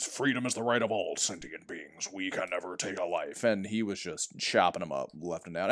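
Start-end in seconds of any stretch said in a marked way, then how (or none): 0.1-3.4 low, soft, solemn robot leader; lightly voiced noble leader, pitched down; Optimus Prime impression. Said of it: "Optimus Prime is all like".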